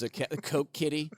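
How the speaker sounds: ordinary delivery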